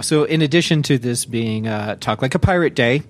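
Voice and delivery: usual speaking voice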